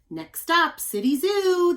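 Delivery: bus driver calling out stops. Acted. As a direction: call it out loud and high. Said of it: "the bus driver would call".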